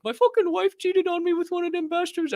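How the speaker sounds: very high, squeaky goblin voice; Hobgoblin voice. Retold or imitated. Retelling the goblin guest's complaint in a very high voice. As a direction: whine it like a wronged cartoon goblin. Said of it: "he was like".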